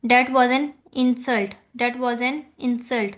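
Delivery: slow, leaning on IN in insult; stress on IN of insult. Shown as a demonstration. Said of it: "इन पर ... stress दे रहे हैं".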